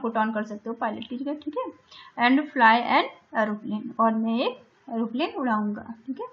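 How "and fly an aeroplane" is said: slow dictation. Shown as a demonstration. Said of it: "मैंने आपके लिए लिखा है".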